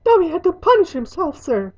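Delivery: high, loud elf voice; squeaky servant explaining himself; contrite house elf. Acted.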